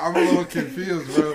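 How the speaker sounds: giggling through it; half-laughed confusion; laughing. Retold or imitated. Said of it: "I was like".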